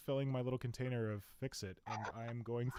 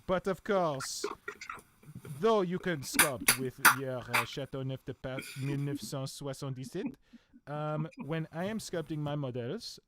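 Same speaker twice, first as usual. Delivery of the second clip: put-on French accent; 4 dB louder than usual. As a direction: savour every word like a French connoisseur. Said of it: "my weird French accents".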